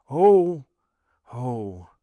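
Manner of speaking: high loud "oh" fading to a murmur; anguished moan. A narrator's reading. Accused wife overwhelmed, moaning then murmuring. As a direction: moan twice, the second sinking away. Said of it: "murmured Madame de Villefort".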